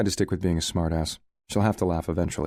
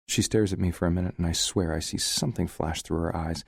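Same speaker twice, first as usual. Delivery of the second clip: ordinary delivery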